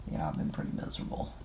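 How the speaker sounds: breathy, worn-out sigh of misery; miserable. Real, not acted. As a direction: sigh it out, sick and drained. Sick, tired, confessing feeling miserable.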